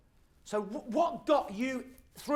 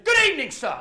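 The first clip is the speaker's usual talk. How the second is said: loud greeting; 6 semitones higher, 9 dB louder than usual. Retold or imitated. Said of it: "he shouts really loud".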